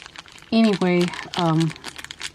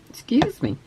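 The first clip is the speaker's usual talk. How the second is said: hiccup jolting the voice; 6 semitones higher than usual. Real, not acted. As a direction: excuse yourself mid-hiccup. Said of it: "got the hiccups".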